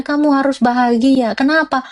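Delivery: ordinary talk, nothing marked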